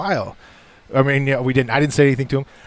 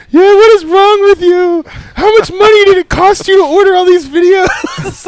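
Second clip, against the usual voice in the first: much higher and louder, slow, mock-tearful mom; hysterical mom; 10 dB louder, slower than usual. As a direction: ham up a distraught mother half laughing. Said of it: "his mom literally, like, started crying".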